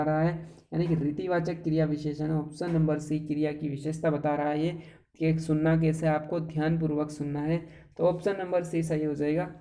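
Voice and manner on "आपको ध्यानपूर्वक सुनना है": ordinary talk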